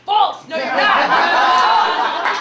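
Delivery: loud, high, drawn-out denial; mock outraged shout. Viewer exposes a Canadian actor, shouting. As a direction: bellow it like calling out a liar. Shouting back at fake American.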